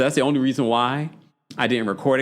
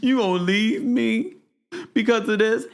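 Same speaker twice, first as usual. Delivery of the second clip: much higher, pleading mock whine; hammed-up tearful plea, pitched way up; 11 semitones higher than usual. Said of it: "She's like".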